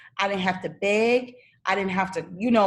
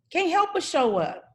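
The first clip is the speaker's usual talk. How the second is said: emphatic higher repeat; 8 semitones higher than usual. Shown as a demonstration. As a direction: repeat "Can't help but show up" much higher, pressing it home. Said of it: "your soulmate can't help but show up".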